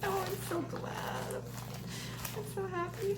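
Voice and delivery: overcome, holding back real tears; happy tears. Real, not acted. Vlogger reading a thank-you card, happily tearful. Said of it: "I'm not crying".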